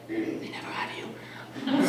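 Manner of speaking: very soft, drawn-out whisper; hushed, voiceless whisper; whispered aside. Acted. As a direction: whisper it slowly like a confided punchline. Punchline aside on trust falls.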